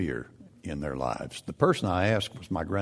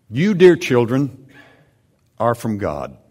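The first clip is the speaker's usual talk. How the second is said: much louder opening address; emphatic reading; 3 semitones higher, 8 dB louder than usual. Shown as a demonstration. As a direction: call it out strongly. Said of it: "I want you to read this to me".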